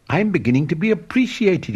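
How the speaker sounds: high, loud burst of delight; young writer crying out with joy; excited cry. A narrator's reading. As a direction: cry it out, thrilled to be noticed. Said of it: "he cried".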